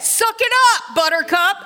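high, sassy suck it up; tough love. Retold or imitated. A preacher voicing God's blunt advice, sassy and high. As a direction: brush off the whining with a sassy snap. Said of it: "that's [name] translation".

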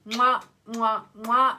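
loud smacking blown kisses; between kisses. Real, not acted. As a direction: blow kisses to the camera. Sign-off blowing kisses.

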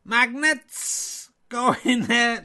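a hammed-up Irish voice that slips into Welsh; failed Irish accent. Irish me complaining to Richard, in a mock Irish that sounds Welsh. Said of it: "You're extremely Welsh".

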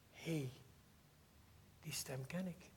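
a soft unvoiced whisper of recognition; hushed recognition. Maria herkent de stem. (zacht fluisterend, vol herkenning)

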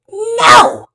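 put-on very loud, high childish yell; yelled refusal. A narrator's reading. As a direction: scream it like a defiant little boy. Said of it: "Pablo yelled".

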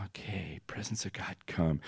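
very soft, whispered invocation; hushed prayer. Retold or imitated. Intern praying over the girl, whispering. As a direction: breathe the invitation almost silently. Intern calls in God's presence.

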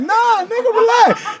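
high, slow mock reassurance; mock calm. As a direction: stretch it out, teasing him to relax. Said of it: "I was like".

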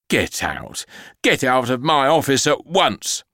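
furious headmistress ordering out; angry boss. Acted. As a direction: throw her out of the office.

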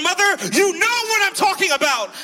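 high, heated outburst at mother; reenacted cursing at mom. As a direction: raise the pitch sharply, confronting her. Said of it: "I'm cursing at my mom".

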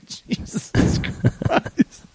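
slow, whispered exasperated sigh; drawn-out, voiceless groan of disbelief; mock exasperation. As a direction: let it out slowly under your breath. Exasperated on the walk home.

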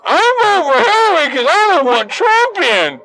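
high, whiny mock voice; mocking voter quote. Ranter mocking people who pick either candidate, high mocking whine. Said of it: "How many people have I heard go".

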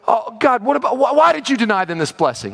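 mock pleading that rises into a high whine; pleading complaint. Preacher voicing a believer's complaint to God about a childless couple, rising high plea. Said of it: "you hear that?".

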